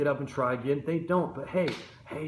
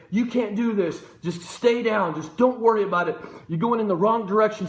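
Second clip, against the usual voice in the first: voice of the enemy; 7 semitones higher, 5 dB louder than usual. Retold or imitated. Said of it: "the enemy's trying to speak in your ear".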